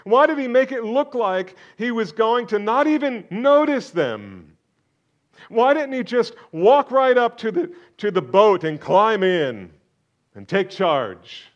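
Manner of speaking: loud, high, driving preacher's questions; preaching at full force. Acted. Preacher demanding answers from the congregation, at full force. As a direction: hammer each question home from the pulpit.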